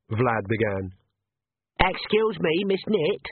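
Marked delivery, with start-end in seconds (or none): 1.8-3.2 put-on high, eager boyish voice; young messenger. A narrator's reading. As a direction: pipe up to interrupt politely. Messenger boy interrupting Agnes.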